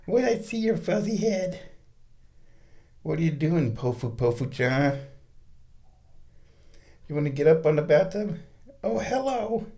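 high cooing voice for the kitten. Real, not acted. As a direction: coo at the cat. Baby talk to the cat.

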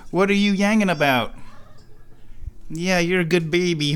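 high, cooing pet voice. Real, not acted. Talking to the meowing cat.

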